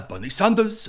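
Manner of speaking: put-on politician impression; Bernie Sanders. Host listing his accents, brief Sanders impression. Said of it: "the accents that I do".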